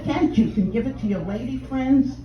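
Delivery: normal talking voice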